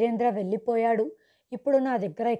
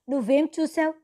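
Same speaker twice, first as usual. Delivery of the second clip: usual speaking voice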